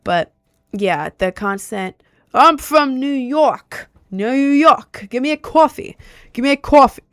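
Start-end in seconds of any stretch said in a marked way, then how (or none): 2.3-7.0 put-on New York accent. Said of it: "how to say it in the accent".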